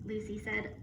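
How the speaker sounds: plain reading voice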